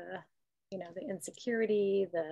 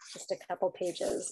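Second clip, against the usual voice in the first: usual speaking voice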